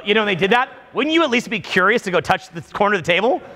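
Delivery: usual speaking voice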